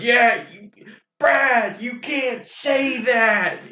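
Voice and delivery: high, drawn-out telling-off; imitating Will telling Brad off, high and slow; mock scolding. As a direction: stretch it out slowly, wagging a finger. Friend imitates director scolding actor.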